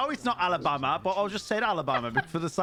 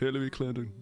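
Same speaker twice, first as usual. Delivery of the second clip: Bill Clinton; 6 semitones lower, 3 dB quieter than usual. Acted. Player capping his impression for chat, mock presidential voice. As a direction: lower southern politician impression. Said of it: "Bill Clinton".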